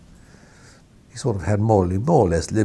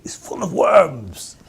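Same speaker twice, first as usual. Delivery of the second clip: much higher, slow, theatrical put-on baron accent; imitating Beaverbrook's accent; 11 semitones higher, 3 dB louder, slower than usual. Editor imitating Beaverbrook revealing the worms, slow and high in his accent. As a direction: draw out the reveal in the old baron's accent, pitched way up. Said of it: "I'm doing my best to imitate his accent".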